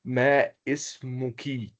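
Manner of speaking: slow "ismuki" with a drawn-out "kee"; kasra pronunciation. Shown as a demonstration. Teacher models the question to a woman, "ismuki" slowly ending in "kee". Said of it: "avec cette prononciation".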